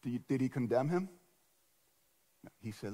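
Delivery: ordinary talk, nothing marked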